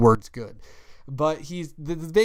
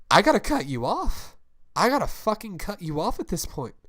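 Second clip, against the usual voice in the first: firm outburst; 8 semitones higher, 5 dB louder than usual. Acted. Acted quarrel, cutting friend off.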